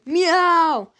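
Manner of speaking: high, loud yowling meow; a screeched "Meow!"; screaming cat. A narrator's reading. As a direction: yowl like an attacking cat. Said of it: "screamed the cats".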